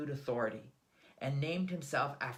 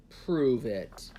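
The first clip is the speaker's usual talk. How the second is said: loud, dark command; clipped, louder imperial demand; emperor's demand; 8 dB louder than usual. A narrator's reading. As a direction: emperor challenging the old man, loud.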